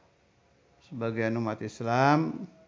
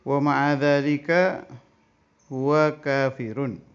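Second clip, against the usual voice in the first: slow, raised Arabic reading voice, drawn out; kitab recitation; 4 semitones higher, 5 dB louder, slower than usual. Acted. Teacher reading the Arabic kitab to students, slow raised recitation. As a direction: intone the Arabic text like a pesantren teacher.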